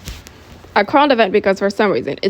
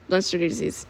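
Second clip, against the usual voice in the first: plain reading voice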